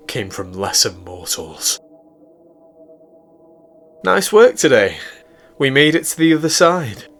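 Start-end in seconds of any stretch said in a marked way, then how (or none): 4.0-7.1 high, loud, playful woman's voice; Celine's cheerful voice. Acted. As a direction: pitch it up, cheerfully congratulating a crewmate. Narrator voices Celine congratulating bunkmate.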